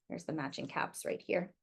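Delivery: whispered, pointing at the page; whispered aside. Real, not acted. Teacher pointing out the caps to children, whispered.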